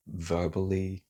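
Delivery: ordinary talk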